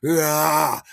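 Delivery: loud, drawn-out roar; acted cry of rage; frenzied yell. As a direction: roar while tearing cloth apart. Acting out shredding his shirt.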